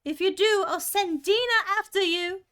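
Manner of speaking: high, loud warning shout; Alice yelling a warning to the animals; calling out loudly. Acted. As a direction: shout the threat out of the house. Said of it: "Alice called out as loud as she could".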